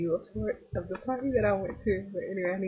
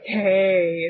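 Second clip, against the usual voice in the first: pimp's hey; 10 dB louder than usual. Retold or imitated. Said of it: "he's like".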